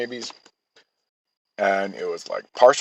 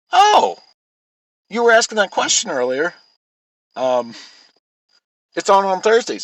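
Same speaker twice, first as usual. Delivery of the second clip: imitating his wife, pitched way up and a bit louder; a mock-innocent high voice, like she's just caught on; high mock-girly voice; 10 semitones higher, 5 dB louder than usual. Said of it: "she's like".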